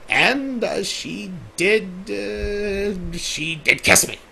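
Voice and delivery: ordinary delivery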